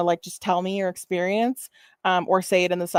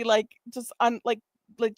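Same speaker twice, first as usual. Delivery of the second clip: ordinary talk, nothing marked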